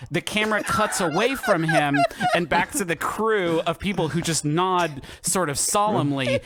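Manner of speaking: ordinary talk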